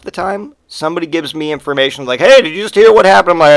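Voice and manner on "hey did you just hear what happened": higher, louder, hurried question; mock eager newsbringer. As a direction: burst in with hot gossip. Acted eager bearer of news.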